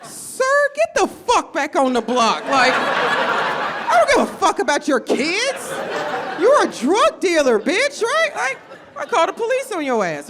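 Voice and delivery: high, loud outraged yelling at the dealer; mock fury. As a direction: tear into him, pitch way up. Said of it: "I was like".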